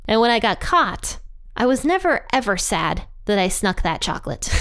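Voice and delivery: ordinary delivery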